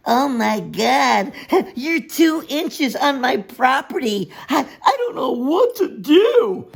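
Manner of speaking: high, drawn-out mimicked whining; mock hysterical complaint. Builder mocking neighbor upset over two inches, hammed-up distress. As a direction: imitate an overwrought, fussing property owner.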